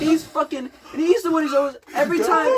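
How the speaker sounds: high, loud exasperated rant; pissed off. Real, not acted. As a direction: rail at him, voice jumping up. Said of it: "I'm pissed right now".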